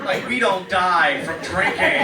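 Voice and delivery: loud, slow comic yell; mock yelling at his drunk sister. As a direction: bellow it slowly for the laugh.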